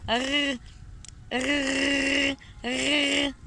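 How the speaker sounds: "rrr" drawn out as a rasp in the throat; attempted throaty R. Shown as a demonstration. Learner retrying after needing water, throaty R. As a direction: practise "rrr" slowly, back in the throat. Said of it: "it's like in their throat".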